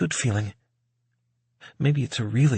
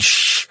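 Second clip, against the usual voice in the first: firm hissed "shh"; shushing; 4 dB louder than usual. A narrator's reading. One waiter hushes the other, firm shush. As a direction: hush your companion. Second shush in acted exchange.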